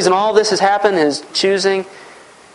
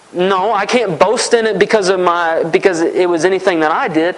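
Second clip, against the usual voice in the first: ordinary delivery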